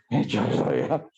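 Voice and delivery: ordinary talk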